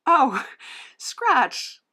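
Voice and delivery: ordinary talk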